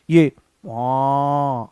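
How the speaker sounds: a long stretched aa; odd aa. Shown as a demonstration. Teacher demonstrating the vowel, long drawn aa. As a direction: drag out the aa vowel. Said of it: "ये अलग आवाज आई है".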